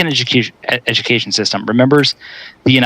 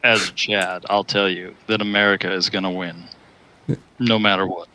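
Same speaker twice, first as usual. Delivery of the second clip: deep baritone; 4 semitones lower, 8 dB quieter than usual. Shown as a demonstration. [name] showing how a baritone sounds. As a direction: lower and softer, chest voice. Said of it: "I said baritone".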